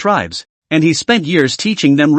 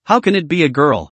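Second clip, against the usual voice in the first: ordinary delivery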